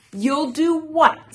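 high, loud defiant question; challenging. A narrator's reading. Toad stepping up to Frog, loud high challenge. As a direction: dare the rival loudly.

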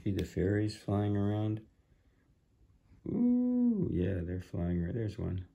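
hushed, excited murmur; soft aside. Real, not acted.

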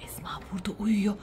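breathy whisper; whisper, she's sleeping. Real, not acted. Teacher asleep nearby.

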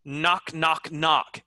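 "Knock" said as nock; "Knock" three times, no K at the front; silent K. Shown as a demonstration. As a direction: start each "Knock" straight on N. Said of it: "It's not knock".

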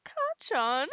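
usual speaking voice

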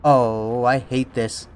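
ordinary talk, nothing marked

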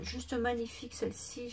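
ordinary talk, nothing marked

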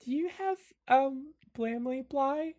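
high, small, hesitant voice; high-pitched bookish questioner; Klaus. Acted. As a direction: ask timidly, drawing it out. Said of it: "Klaus looks up and says".

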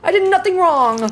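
high, wailing panicked yell; panicked protest. Real, not acted. Streamer seeing demons in the kitchen, panicked wailing. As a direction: cry out in alarm, protesting innocence.

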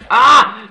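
loud, high yelp of panic; startled scream. Real, not acted. Gamer hit by sudden danger, panicked scream.

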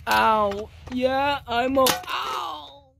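high, strained cries of pain. Acted. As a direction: answer through sudden pain. Hurt friend answers.

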